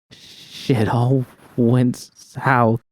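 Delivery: slow, broken words pushed out through sobs; V, battered, chokes the words out slowly; sputtering between sobs. A narrator's reading. V tells Judy through sobs, slow and sputtering. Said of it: "she sputtered between sobs".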